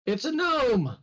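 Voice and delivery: high, slow called-out correction; guard yelling back. Acted. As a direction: shout down that it's only a gnome. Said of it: "the guard yells back and says".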